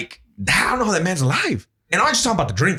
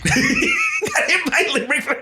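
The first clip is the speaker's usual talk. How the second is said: laughing; much slower than usual. Real, not acted. Laughing at his own story.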